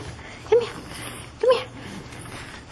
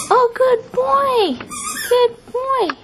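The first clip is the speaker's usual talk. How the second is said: excited dog praise; 9 semitones higher than usual. Real, not acted. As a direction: cheer the dog on. Dog climbs, owner cheers.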